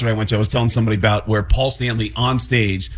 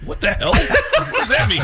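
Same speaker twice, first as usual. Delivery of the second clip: baffled; slower than usual. Retold or imitated. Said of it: "and I went".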